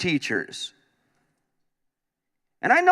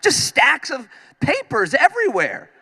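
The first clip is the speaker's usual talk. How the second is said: put-on higher, pleading kid voice; whiny kid; 9 semitones higher than usual. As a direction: beg mom to stop grading. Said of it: "I would always say".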